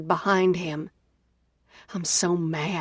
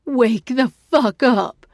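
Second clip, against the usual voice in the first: a loud, higher, slow yell into the phone; screaming; 4 semitones higher, 5 dB louder, slower than usual. A narrator's reading. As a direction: scream it at her to wake up. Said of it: "she screams".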